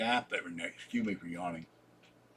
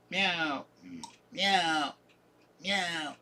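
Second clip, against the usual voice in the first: cat meows; 5 semitones higher, much slower than usual. Pretend. Jokes about cat naps.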